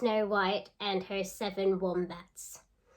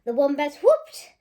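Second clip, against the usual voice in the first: lively storybook reading; reading to children; 3 semitones higher, 7 dB louder than usual. Acted. Teacher reading a picture book aloud to children. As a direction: read it out with relish for the kids.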